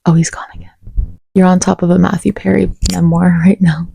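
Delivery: normal talking voice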